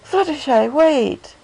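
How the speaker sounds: high, loud call after someone running off; Rarity calling to Fluttershy as she gallops out, loud and high; calling after her. A narrator's reading. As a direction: shout for her to stop. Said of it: "Rarity called after her".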